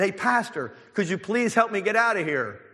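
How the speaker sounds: high-pitched call to a passer-by; acted plea for help. Trapped man calls to passing pastor.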